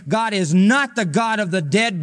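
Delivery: ordinary talk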